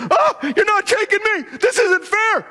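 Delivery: what Daniel was not like, a high whiny complaint; whiny panic. Retold or imitated. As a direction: play the sniveling coward being arrested. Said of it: "He wasn't like this".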